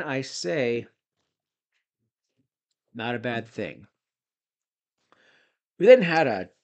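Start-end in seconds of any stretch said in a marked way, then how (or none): 5.8-6.5 jowly voice. Retold or imitated. Jowly mock of the Hardy signing.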